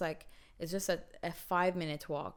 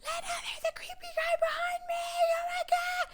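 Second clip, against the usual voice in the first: panicked scream. Retold or imitated. Screaming to her sister on the phone, terrified. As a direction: scream for help while running. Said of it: "I was just screaming".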